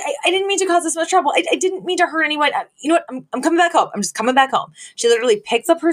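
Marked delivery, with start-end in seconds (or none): 0.0-4.7 high-pitched rushed apology, all in a fluster; high, fast guilty daughter. Retold or imitated. Daughter apologizes to quarreling parents in a high, rushed voice. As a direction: gush out the sorry, way up high. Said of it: "she's like".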